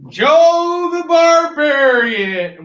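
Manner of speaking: loud, drawn-out, high announcer cry; big slow bellowed title; hyped title announcement. Pretend. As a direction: stretch it out like a ring announcer.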